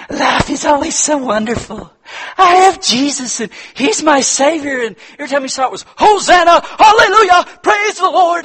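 high, loud, over-sweet church-lady voice. Retold or imitated. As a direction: ham up a relentlessly cheerful believer. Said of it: "one of these Christians that said".

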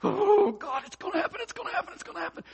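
high-pitched panicky repetition; stressed out. Acted. Stressed believer fretting to God, frantic and high-pitched. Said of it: "that's where stress comes".

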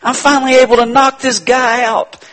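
high, loud gloating devil voice; a lightly hammed-up devil, pleased with himself. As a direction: crow like the devil spotting his chance. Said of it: "he says".

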